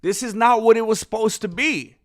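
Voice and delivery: ordinary delivery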